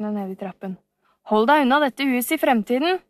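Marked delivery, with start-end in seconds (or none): 1.3-3.0 higher, louder shouted warning; stern warning. Acted. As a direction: send him off for good. Warning the villain off.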